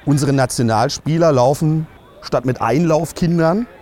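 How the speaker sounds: normal talking voice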